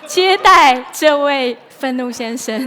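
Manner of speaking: trying to recover from a giggle fit; laughing through it. Real, not acted. Preacher still laughing at her joke, slowed down. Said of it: "笑完了吗".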